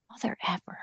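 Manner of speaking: hushed frustrated whisper; whispered curse. Real, not acted. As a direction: mutter it under your breath. Frustrated with the voice app.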